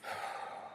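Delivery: soft, breathy sigh. Real, not acted. Pausing in a rant about respect, sighing. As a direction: let out a quiet sigh.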